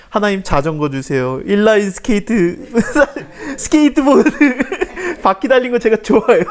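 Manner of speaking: put-on high, laughing childish plea, breaking into giggles; mock a kid's prayer while cracking up; laughing through it.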